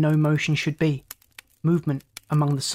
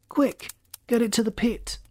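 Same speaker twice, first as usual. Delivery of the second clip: high-pitched exclamation; pressing, raised-pitch command; urgent order; 7 semitones higher than usual. A narrator's reading. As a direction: rush the crew along. Urgent order to his crew.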